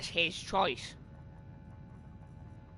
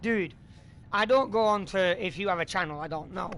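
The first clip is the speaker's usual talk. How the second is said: heated raised voice; snapping back, louder and higher; angry; 4 semitones higher, 4 dB louder than usual. Real, not acted. Streamer heated at commenter.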